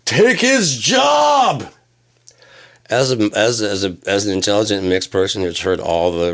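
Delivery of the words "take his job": slow, drawn-out, high shouted yell. Retold or imitated. Comic mocks a bigot shouting slowly and high. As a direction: drag it out like an angry bigot shouting. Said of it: "I wouldn't be like".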